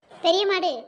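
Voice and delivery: loud, cross outburst; scolding name-calling. Acted. Mother telling her daughter off, loud and cross. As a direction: snap the insult out loudly.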